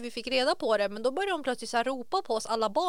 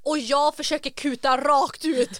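normal talking voice

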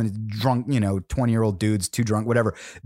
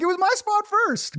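put-on much higher, childish protest; whiny retort. Mock-sulking older brother claims the bar, high whine. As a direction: squeak it out like a sulking kid. Own retort, acted quote.

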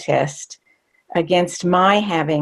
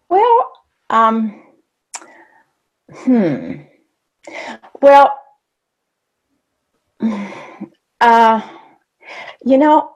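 flustered stalling; 5 semitones higher, 5 dB louder, slower than usual. Retold or imitated. Flustered young self stalling for answer.

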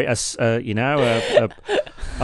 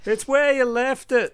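mother calling back; 12 semitones higher than usual. Pretend.

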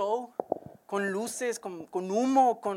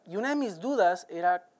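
ordinary delivery

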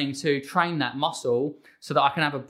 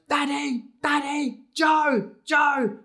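put-on demanding toddler shouting; 11 semitones higher, much slower than usual. Dad imitating his little girl calling for him, demanding toddler shout. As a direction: imitate a small child calling out, very high. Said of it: "she'll scream and shout".

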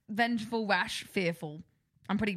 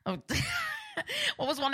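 ordinary delivery

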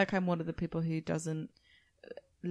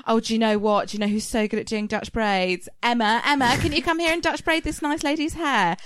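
louder, higher, calling across the salon; calling out to colleague; 5 semitones higher, 8 dB louder than usual. Retold or imitated. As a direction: hairdresser shouting for Emma. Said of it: "but then she went".

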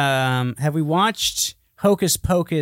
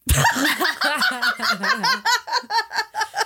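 a long, high cackle of delight; high shrieking laugh. Real, not acted. Laughs at slutty cat idea.

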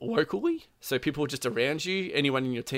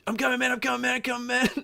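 high-pitched voice of a medic rushing over; imitated urgent calling; 13 semitones higher, faster than usual. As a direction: call it out high, repeating urgently. Retold medic calling while running over.

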